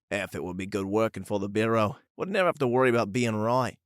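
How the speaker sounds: lively put-on Aussie banter; amateur Australian voices trading quips; attempted Australian accent. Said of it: "not sure how good that accent was".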